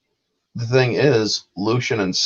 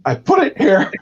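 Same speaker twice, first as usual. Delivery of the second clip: high, far, squeaky put-on voice; a frantic little man insisting; mock panic; 3 dB louder than usual. Tanis panicking in the armory, high squeaky voice.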